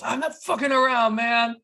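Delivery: high, loud menacing snarl; robber's threat. Retold or imitated. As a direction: shout the threat at him. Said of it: "The robber just goes".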